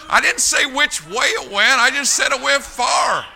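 high, loud, drawn-out boast; shouted punchline. Real, not acted. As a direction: belt out the punchline slowly.

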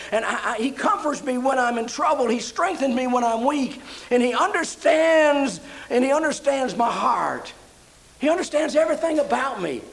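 high, rising praise, swelling and falling away; fervent preaching. Acted. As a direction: preach it with mounting fervor.

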